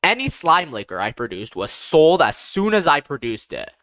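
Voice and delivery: ordinary delivery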